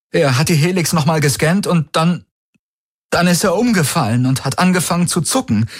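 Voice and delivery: high, breathless-sounding distress; agitated account. A narrator's reading. Shocked friend recounting the collapse, agitated.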